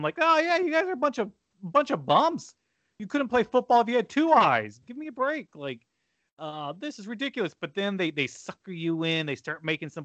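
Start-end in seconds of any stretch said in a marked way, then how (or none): 0.2-7.5 higher, quick sneering jeers at the players; mocking the team. Fan mocking his losing team on TV, sneering. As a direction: taunt the bums like a heckler. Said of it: "I'm openly mocking them".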